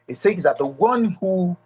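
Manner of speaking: ordinary delivery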